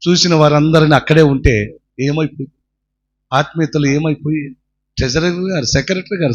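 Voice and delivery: usual speaking voice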